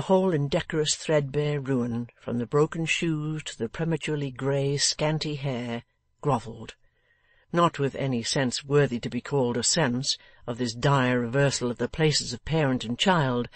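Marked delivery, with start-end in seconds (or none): none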